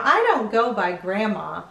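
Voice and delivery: usual speaking voice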